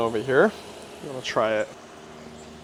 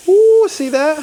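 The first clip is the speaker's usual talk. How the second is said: high, loud thrilled exclamation; excited ooh; 9 dB louder, slower than usual. Real, not acted. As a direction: gush over the perfect sear. Showing off grill marks.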